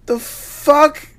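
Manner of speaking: high, disbelieving exclamation; incredulous. Real, not acted. Co-host reacts to Trump throwing paper towels, high and incredulous. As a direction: ask it flabbergasted.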